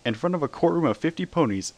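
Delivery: ordinary delivery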